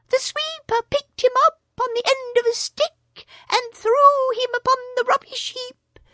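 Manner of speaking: a small proud bird boasting; Darzee the tailorbird. A narrator's reading.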